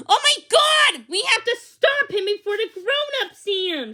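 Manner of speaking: high, loud panicked outburst; urgent panic to catch the dog. A narrator's reading. Becky chasing the escaped dog, high panicked cry.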